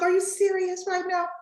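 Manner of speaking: ex-husband. Retold or imitated. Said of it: "He was like".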